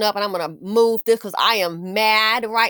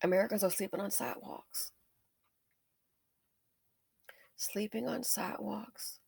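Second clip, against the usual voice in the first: quiet dismay; 12 dB quieter than usual. Real, not acted. Quiet repetition for emphasis.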